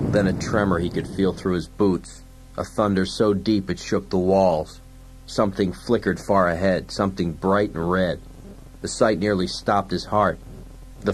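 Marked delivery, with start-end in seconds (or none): none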